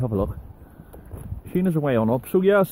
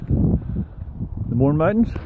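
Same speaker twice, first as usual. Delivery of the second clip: slow, drawn-out ASMR murmur; much slower than usual. Pretend. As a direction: breathe the words slowly like ASMR. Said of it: "A S M R".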